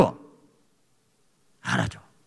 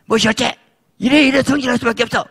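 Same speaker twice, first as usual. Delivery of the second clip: angry husband; 13 semitones higher than usual. Acted. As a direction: flare up, full of grievance. Husband flares up.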